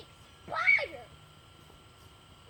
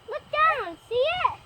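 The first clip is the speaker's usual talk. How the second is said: calling out; 6 semitones higher, 6 dB louder, slower than usual. Real, not acted. Calling to Mikey.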